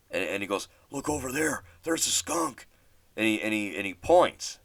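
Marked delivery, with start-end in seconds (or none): 0.9-2.7 higher, urgent retold friend; excited pointing.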